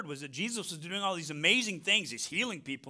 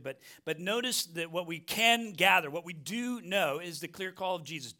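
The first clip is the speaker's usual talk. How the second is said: ordinary delivery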